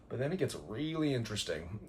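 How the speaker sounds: ordinary talk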